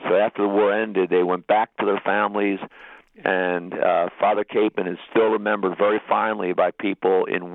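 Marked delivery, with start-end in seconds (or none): none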